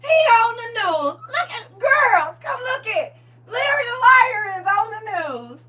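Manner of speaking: high, loud, laughing call; excited calling out. Retold or imitated. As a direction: summon the girls to the TV, thrilled. Calling daughters to the TV.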